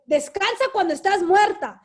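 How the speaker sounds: lightly voiced nagging mother; mom's orders, retold with emphasis; imitating mom. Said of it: "what my mom would tell me".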